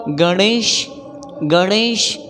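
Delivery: गणेश with a curled-tongue ṇ and "sh" ending; retroflex ण. Shown as a demonstration. Teacher models गणेश for learners, retroflex ṇ. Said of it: "मूर्धा में आपको जीभ को touch".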